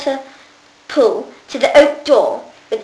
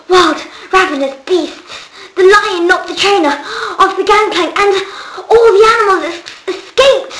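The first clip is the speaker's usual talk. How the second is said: higher and louder, climbing to a cry; rising excitement; 7 semitones higher, 4 dB louder than usual. Acted. Jonathan tells the girls about the beasts, climbing to a cry. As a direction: build the drama up to escaped. Jonathan describes escaped animals.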